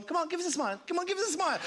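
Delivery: high, coaxing photographer patter; wedding photographer. Retold or imitated. Photographer coaxing a smile from his sitter, high and coaxing. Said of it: "like a wedding photographer going".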